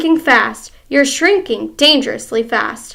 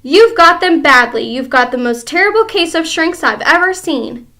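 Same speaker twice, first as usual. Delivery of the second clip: louder, gloating; crying out; 4 semitones higher, 7 dB louder than usual. Acted. Husband crying out at frightened wife, louder. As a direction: hammer the diagnosis home. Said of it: "cried Mister Twit".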